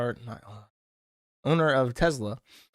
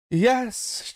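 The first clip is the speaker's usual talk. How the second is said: normal talking voice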